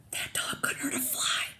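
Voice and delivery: high, teasing whisper; amused whisper. Acted. Burglar mocking the dog, whispering. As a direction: whisper it with a giggle in it. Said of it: "whispered one burglar".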